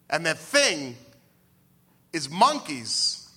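drawn-out dramatic reveal; slow punchline. Acted. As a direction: spell it out slowly.

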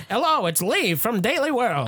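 high, hammed-up newshound introduction; mock old radio reporter; old-timey reporter voice. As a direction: introduce yourself like a vintage newsman. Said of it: "all in that old-timey voice".

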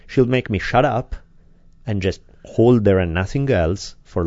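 ordinary delivery

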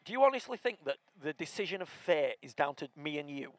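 ordinary talk

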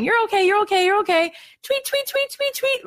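put-on very high cooing voice over a crying baby; mock sing-song mom. Mom hushing her crying baby, in a high mock coo. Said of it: "You're going".

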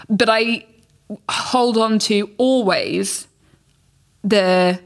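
ordinary talk, nothing marked

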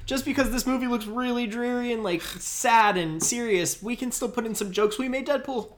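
high put-on voice, hammed up; squeaky comic company voice; mock studio spokesman. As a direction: play the defensive studio in a silly high pitch. Playing Fox defending its jokes.

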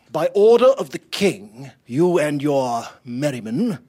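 loud official proclamation; commanding. Acted. Captain confines the crew to dock, commanding loudly. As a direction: lay down the order with force. Captain issues order to skipper.

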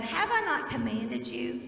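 slow, solemn reading of Joshua; scripture reading. Acted. Pastor reading scripture to children, slow and solemn. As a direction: lift the verse out slowly for the kids. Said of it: "He says".

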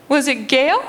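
high, rising mom guess; tentative put-on high mom voice; mom's voice. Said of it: "And she goes".

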